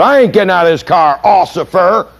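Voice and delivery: put-on high, lightly slurred drunk voice; a hint of a stubborn drunk; defiant drunk driver. Stopped driver refuses the cop, lightly slurred. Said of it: "much like the first guy".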